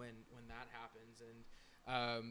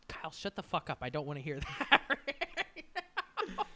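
laughing retort; 4 semitones higher, 6 dB louder than usual. Retold or imitated. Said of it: "J D goes".

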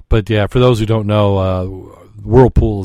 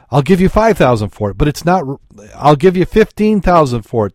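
rising, louder offers, higher pitch; eager bidding; 5 semitones higher, 4 dB louder than usual. Acted. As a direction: build the bids with mounting eagerness.

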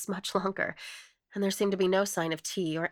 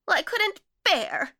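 high, loud wail; pitched way up, lamenting loudly; 13 semitones higher, 8 dB louder than usual. A narrator's reading. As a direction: wail it in distress. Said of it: "wailed the other".

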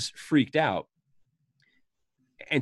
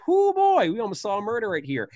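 high, loud hoo of relief; relieved owner; 9 semitones higher, 5 dB louder than usual. Retold or imitated. The bar owner fanning himself after the scare, high hoo. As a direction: blow out relief. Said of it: "'cause he's like".

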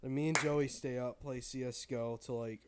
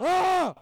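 loud high "ah" shout; sudden yell; 14 dB louder than usual. Real, not acted. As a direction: let out a short, high-pitched yell. Sudden yell amid banter.

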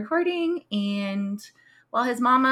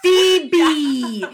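excited shout; 7 semitones higher, 9 dB louder than usual. Retold or imitated. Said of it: "And she's like".

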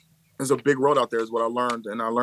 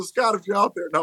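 ordinary talk

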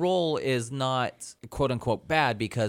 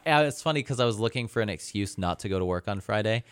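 ordinary talk, nothing marked

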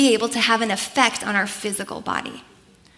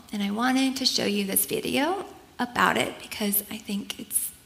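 whisper; 2 semitones lower, 7 dB quieter than usual. Real, not acted. Quiet before showing the video.

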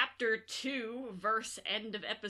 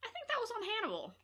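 quick inner thought; 10 semitones higher, 3 dB louder, faster than usual. Retold or imitated. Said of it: "And to instantly think".

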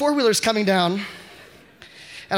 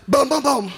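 mock gunshot sounds; 6 semitones higher, 4 dB louder than usual. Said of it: "and he goes".

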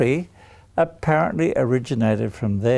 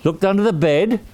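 usual speaking voice

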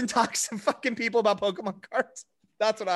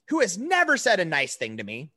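ordinary delivery

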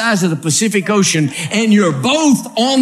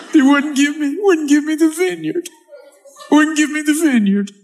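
higher, half-laughing mock whine of a spoiled king; whiny sulking king; 7 semitones higher than usual. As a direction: whine it like a pouting child king. Preacher acts sulking King Ahab.

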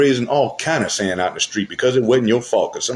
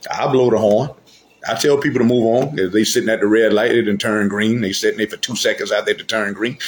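ordinary talk

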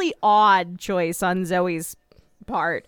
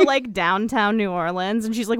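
ordinary talk, nothing marked

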